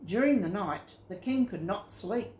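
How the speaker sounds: ordinary delivery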